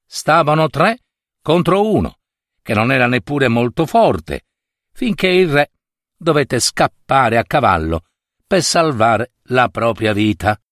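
ordinary delivery

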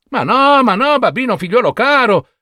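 very high, loud exasperated cry; reproaching mother. Acted. Mother reproaches Babino, high and loud. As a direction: wail at your hopeless son. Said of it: "lo rimproverò la madre".

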